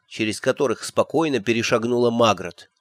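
ordinary delivery, nothing marked

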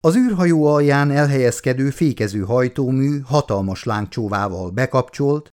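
usual speaking voice

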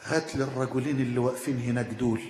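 low hushed retelling of a whisper, not truly whispered; همس عمر. Retold or imitated. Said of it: "فقال له عمر بهمس". (همس خفيف مُعاد)

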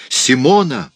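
high, loud, desperate call; shouting. A narrator's reading. Raoul cries out again for Simone, desperate shout. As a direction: cry the name out in alarm. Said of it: "закричал Рауль".

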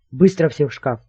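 plain reading voice

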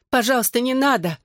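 high, anxious begging; pleading cry. A narrator's reading. Mother begs her son to stop, high plea. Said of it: "закричала мама".

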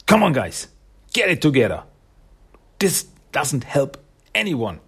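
playing the only sensible one; acted exasperation. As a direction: plead with squabbling friends to pull themselves together. Voicing Sally's exasperated appeal.

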